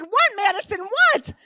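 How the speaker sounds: loud, high-pitched mock scream; screamed back. Mother screams back at her horrified daughter, hammed-up yell. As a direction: yell back in exaggerated panic. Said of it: "I screamed back and I said".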